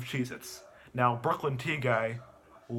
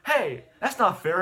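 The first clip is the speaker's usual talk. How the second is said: mock protest; 5 semitones higher, 5 dB louder than usual. Guy protests boy took his chips.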